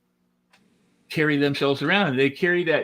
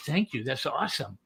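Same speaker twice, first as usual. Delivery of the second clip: contained thanks; 7 dB quieter than usual. Shown as a demonstration. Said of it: "You're like".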